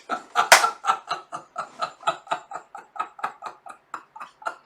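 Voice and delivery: long, helpless run of laughter; real laughing fit. Real, not acted. Host cracking up at the story, laughing hard. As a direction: keep laughing until out of words.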